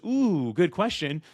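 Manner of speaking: mock impressed pondering. Everyone else answering the host, mock impressed and stalling. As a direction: imitate a stumped guest, stretching the first sound. Mimics other guests caught unprepared.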